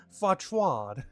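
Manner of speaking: "Fauchard" said the French way, like fwah-shar, laughing; mock French accent on "Fauchard", amused. Shown as a demonstration. Streamer tries the French name for chat, in a mock French accent. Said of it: "How do you say it with a French accent?".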